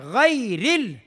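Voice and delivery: غیر ال with a drawn ay diphthong; soft yaa. Shown as a demonstration. Teacher naming soft yaa, gliding غیر ال. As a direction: glide slowly through ay in غیر ال. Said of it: "یہ یا لین ہے".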